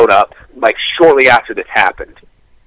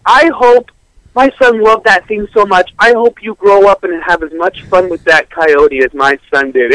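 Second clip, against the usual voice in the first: higher, slow sweet mother; sweet mom; 7 semitones higher than usual. Retold or imitated. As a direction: lay on the innocent mom kindness. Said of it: "And she's like".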